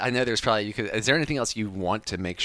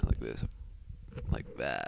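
low, soft sultry voice; sexy voice; 9 semitones lower, 10 dB quieter, slower than usual. Shown as a demonstration. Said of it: "Let's get that sexy voice going".

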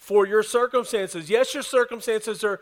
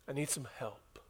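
much softer, lower, a small admission; quiet plea; 7 semitones lower, 17 dB quieter than usual. Retold or imitated. Struggling person admits to a friend, hushed. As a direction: let it drop to a hushed ask.

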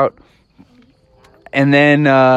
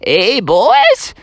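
higher, drawn-out prospector voice; old miner; 6 semitones higher, slower than usual. Acted. Old miner calls to boys on the river, prospector voice. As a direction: hail passers-by like an old-time prospector. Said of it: "they rode by an old miner".

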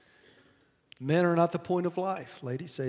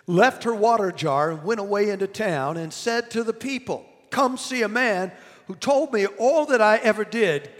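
raised reading of the verse; scripture reading; 4 semitones higher, 7 dB louder than usual. Acted. Preacher reading John aloud to the congregation, raised. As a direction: read the Bible verse out to the church.

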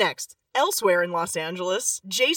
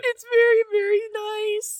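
teary; 12 semitones higher, 4 dB louder than usual. Real, not acted. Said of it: "You legit crying up in here".

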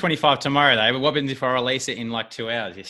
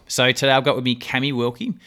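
ordinary talk, nothing marked

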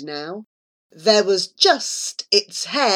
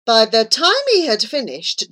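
ordinary delivery, nothing marked

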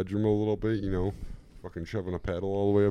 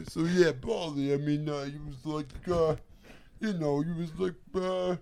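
Rocky impression; 10 semitones higher than usual. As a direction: play it like a punch-drunk boxer. Rocky voice continues.

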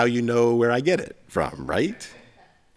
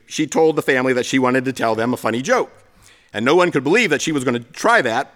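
ordinary delivery

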